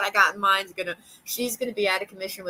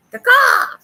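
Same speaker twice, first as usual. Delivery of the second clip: high shrieking crow caw; loud crow caw; 13 dB louder than usual. Acted. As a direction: screech it like a crow. Crow voice at dumpster.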